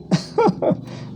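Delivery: breathy, high burst of laughter; amused laugh. Real, not acted. Speaker dismissing a strange argument, amused laugh. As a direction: laugh it off as absurd.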